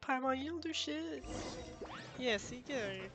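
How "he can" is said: ordinary talk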